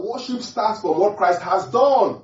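high, loud preaching cry; forceful proclamation. Real, not acted. Preacher to believers on worship, forceful proclamation. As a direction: proclaim it loudly, stretching the last word.

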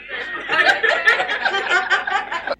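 high, soft staccato chuckle; long run of high, quiet giggles; mocking laugh. Mocking the shouted prayer.

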